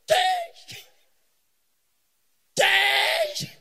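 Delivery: very loud, high slogan yell; full-volume mock rallying shout; hammed-up shout. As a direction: bellow it at full force, drawn long. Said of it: "let me shout".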